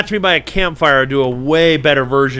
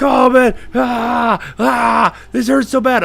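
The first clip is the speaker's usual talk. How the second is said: hammed-up injured friend; exaggerated ow-it-hurts complaint; mock pain; 8 semitones higher, slower than usual. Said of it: "he's like".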